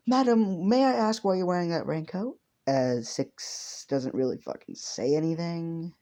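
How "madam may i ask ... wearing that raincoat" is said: put-on higher, courteous young hero asking Six; polite hero voice, pitched up. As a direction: lift the voice into an earnest, overly polite hero.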